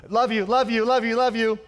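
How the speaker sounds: high, loud careless sing-song love you; glib. Retold or imitated. Pastor showing a glib, careless love you, high and loud. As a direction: toss it off like it's nothing. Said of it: "a pretty glib way".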